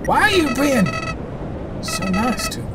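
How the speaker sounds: small, pleading confusion; bewildered villain. Acted. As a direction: ask it softly, pitch climbing in disbelief.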